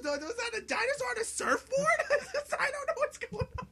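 very high-pitched panicked babble, acted out; mumbled freak-out. Player retelling the T-Rex gliding at him, hammed-up freak-out. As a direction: squeal and jumble it like a mock panic. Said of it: "I just start mumbling and freaking out".

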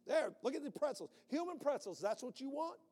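high, loud gloating show-off; hammed-up boast of a strongman; mock taunt. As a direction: crow over the tied-up crowd. Mock God showing pretzels.

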